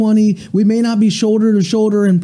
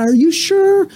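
tearful; 11 semitones higher, slower than usual. Acted. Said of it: "she says with tears flooding".

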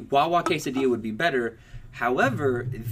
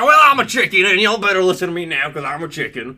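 Southern cartoon rooster; 7 dB louder than usual. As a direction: imitate a big Southern cartoon chicken holding forth. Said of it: "he just talks like with a southern accent".

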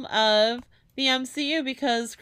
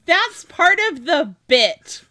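laughing through the words, high and loud; loud, high laughing defense of the bit; 5 semitones higher, 9 dB louder, slower than usual. Real, not acted. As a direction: giggle out the excuse.

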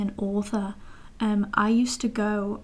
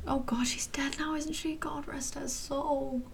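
whispered, higher, slowing on the last words; hushed whisper; 4 semitones higher, 9 dB quieter, slower than usual. Real, not acted. Realising the contestant has died: reverent whisper. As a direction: drop to a reverent hush.

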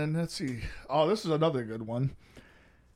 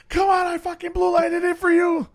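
partner shouting; 14 semitones higher, 10 dB louder than usual. Retold or imitated. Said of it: "He goes".

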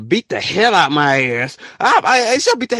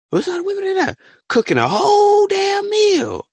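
high incredulous shout. Real, not acted. Shocked about women cooking.

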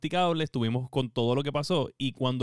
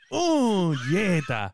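joyful exclamation; 2 semitones higher, much slower than usual. Retold or imitated. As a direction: whoop it like you just won. Retold joy at confirmation email.

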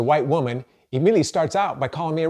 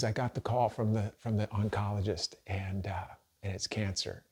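much softer, low, tearful hush; hushed bad news; 4 semitones lower, 10 dB quieter than usual. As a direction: tell it as she did, barely holding it. Wife tearfully delivering cancer news.

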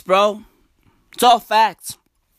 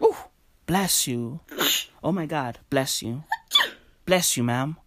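soft, lowered cooing reverence; tender blessing; 4 semitones lower, 6 dB quieter than usual. Acted. Bystander blessing a person who sneezes, tender mock concern. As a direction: hush your voice, hammed-up sympathy.

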